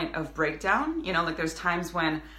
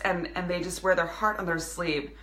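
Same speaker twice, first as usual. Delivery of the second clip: ordinary delivery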